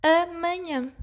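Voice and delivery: each syllable given its own beat; teacher sounding out syllables. Shown as a demonstration. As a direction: slowly split into three syllables. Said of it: "têm três sílabas".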